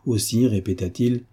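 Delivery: ordinary delivery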